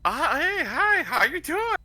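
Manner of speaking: high, slow fake-innocent greeting; caught-out innocent. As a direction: act like nothing is wrong, sweetly. Caught pickpocket plays innocent.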